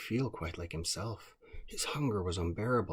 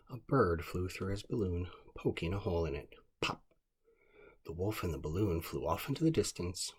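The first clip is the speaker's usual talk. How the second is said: ordinary delivery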